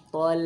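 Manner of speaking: "tol" pulled back, heavy. Shown as a demonstration. Teacher demonstrates the heavy tol. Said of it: "ditarik, bukan tol ke depan".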